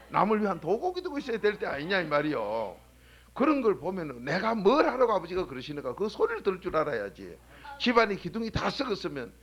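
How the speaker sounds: loud, driving sermon voice; preaching at full force. Acted. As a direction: preach it hard to the pews. Preacher exhorting the congregation.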